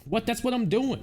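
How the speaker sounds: high, indignant reply; put-upon worker talking back; protesting game character. Acted. Paul protests in game scene.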